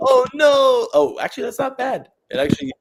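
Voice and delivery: high loud startled cry; surprised yelp. Real, not acted. Reacting to her drawing's sudden change, startled and loud. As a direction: yelp then relieved surprise. Shocked then relieved at result.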